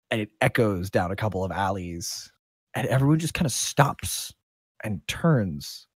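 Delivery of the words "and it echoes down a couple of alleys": ordinary talk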